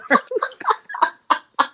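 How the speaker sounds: a short high laugh, rising. Real, not acted. Host laughing at her own punchline. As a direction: let a high giggle escape.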